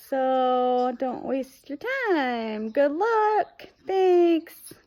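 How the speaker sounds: high, drawn-out mock-helpful sign-off; mock cheery brush-off. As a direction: imitate a clerk chirping callers away. Hotel clerk's brush-off retold.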